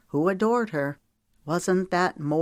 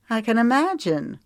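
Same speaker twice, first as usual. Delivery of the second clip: chuckling; 6 semitones higher, 4 dB louder than usual. Acted. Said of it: "she chuckled".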